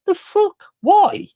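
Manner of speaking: high incredulous squeak; can't believe it, pitch shoots up. Real, not acted. Streamer ranting at celebrity actors, high disbelief.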